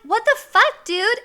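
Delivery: high, loud indignant cry; acted outrage. Woman to the guy who looked in, outraged. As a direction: re-enact an outraged protest at full voice.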